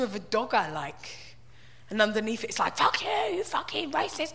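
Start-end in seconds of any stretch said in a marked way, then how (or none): 2.8-4.3 high, mock-angry snarl of a troll comment; mock troll outburst. Reading a troll's comment in a mock-angry voice. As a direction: spit it like an online troll.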